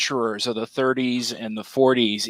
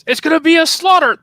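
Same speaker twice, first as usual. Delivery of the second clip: movie quote; 13 semitones higher, 6 dB louder than usual. Retold or imitated. Quoting movie line excitedly.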